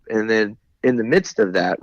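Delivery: normal talking voice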